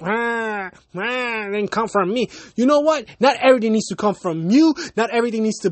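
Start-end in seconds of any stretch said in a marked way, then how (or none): 0.0-2.3 whiny pouting imitation of a sulker; mock pouting. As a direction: mimic a pouty complainer. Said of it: "put a pouty face like".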